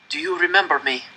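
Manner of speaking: put-on high, strained croak of a starving boy. A narrator's reading. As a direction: barely get the words out, croaking. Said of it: "I croaked".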